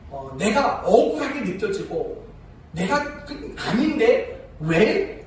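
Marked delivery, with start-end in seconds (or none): 3.6-5.2 indignant whine. Retold or imitated. Imitating someone rebuked unfairly.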